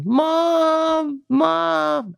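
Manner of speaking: high, drawn-out mock whine; whining child. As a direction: imitate a kid whining for mother from bed. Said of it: "like, whining a little".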